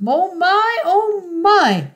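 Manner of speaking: high, drawn-up cooing admiration; marvelling. A narrator's reading. Mister Fox admiring the biggest geese, marvelling.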